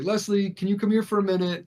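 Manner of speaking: high, quick, uneasy summons; alarmed calling. Retold or imitated. Aunt summons mom on seeing a cop, alarmed calling. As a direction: mimic an aunt trying to fetch help.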